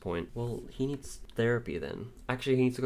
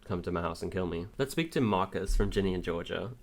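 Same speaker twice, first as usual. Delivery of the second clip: ordinary delivery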